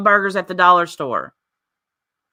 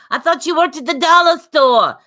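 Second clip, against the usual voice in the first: mock New York accent, pitched higher and louder; 8 semitones higher, 4 dB louder than usual. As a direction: ham up a New York woman's accent, voice raised high. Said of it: "That accent".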